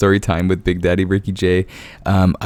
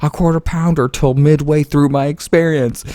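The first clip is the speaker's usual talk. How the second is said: high, mocking customer voice; mock-outraged customer, pitched way up; indignant customer; 9 semitones higher than usual. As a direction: squeak it out like a clueless diner. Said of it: "she's like".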